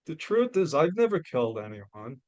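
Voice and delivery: ordinary talk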